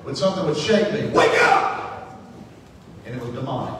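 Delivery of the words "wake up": harsh, abrupt shouted command; explosive imitation of a waking call; sudden wake-up shout. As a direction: jolt a sleeper awake with a shout. Demon waking him from sleep.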